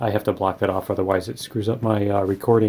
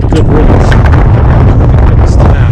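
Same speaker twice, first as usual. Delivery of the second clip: loud, raised voice outdoors; shouting over wind; 2 semitones higher, 16 dB louder than usual. Real, not acted. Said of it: "if you can hear me over the wind".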